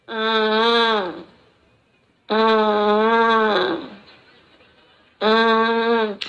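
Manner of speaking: low droning fake whine; long, low mock whimper; half-hearted child's crying. Shown as a demonstration. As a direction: imitate a child fussing without real tears. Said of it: "ada anak nangis gini nih".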